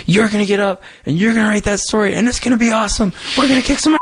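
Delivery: imitating a big muscular friend's rah-rah cheer; hyped-up pep talk. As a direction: pump him up like a big wrestler coach. Greg's hyped pep talk, retold.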